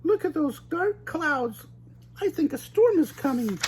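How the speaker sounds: high cartoon girl warning of a storm; Velma voice. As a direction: point up and warn the gang. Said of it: "Velma pointed to the sky".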